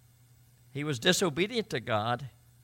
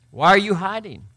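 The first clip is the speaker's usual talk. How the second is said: loud, raised, demanding question; calling out; 6 semitones higher, 9 dB louder than usual. Acted. God questioning Adam in hiding, loud challenge. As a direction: call the question out to someone hidden.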